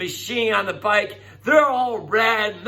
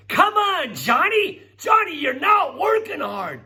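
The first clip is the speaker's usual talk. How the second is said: coach yelling; 10 semitones higher than usual. Retold or imitated. Coach yelling at Johnny on the bike, shouting. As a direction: yell it like a coach pushing a lazy player. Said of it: "your coach is yelling at you".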